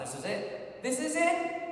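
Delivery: rising to a high, loud wail of panic; panicked inner thought. Retold or imitated. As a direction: spiral from worry into a near-sung panicked cry. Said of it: "And I thought".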